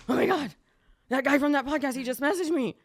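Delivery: loud, high squeal of disbelief; excited. Retold or imitated. Telling her husband the podcast host messaged her, loud and excited. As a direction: gush like you just got the message of your dreams.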